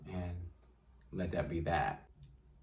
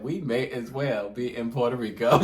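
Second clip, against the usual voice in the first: grandma's line through laughter; laughing retelling; 3 semitones higher, 4 dB louder than usual. Retold or imitated. Retelling grandma's complaint, laughing through it. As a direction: say it cracking up. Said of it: "She said".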